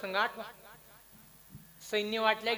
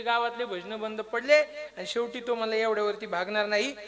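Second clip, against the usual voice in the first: usual speaking voice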